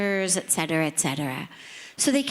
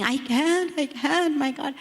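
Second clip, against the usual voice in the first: high, broken tearful refusal; retold crying; 7 semitones higher than usual. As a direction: quote yourself refusing through tears. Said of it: "I'm crying".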